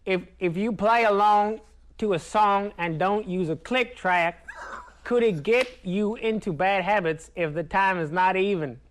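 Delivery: slow Southern drawl; put-on Tennessee accent, drawn out; American Southern accent. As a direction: read it slowly as a Nashville Southerner. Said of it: "The only accent I can do is American Southern accent".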